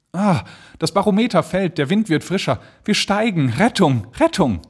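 viel höher, jubelnd; joyful relief. Acted. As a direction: ruft die Rettung freudig aus. Balloon rises, rescue. (Reisende über die Rettung, jubelnd)

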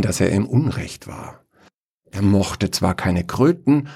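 plain reading voice

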